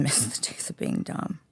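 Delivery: soft whispered aside through a laugh. Shown as a demonstration. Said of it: "I say it all the time".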